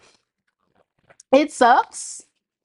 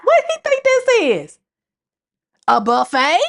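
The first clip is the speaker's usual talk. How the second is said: high, incredulous sass; indignant; 7 semitones higher than usual. Real, not acted. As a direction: sneer that this is a buffet. Mock indignant rhetorical question.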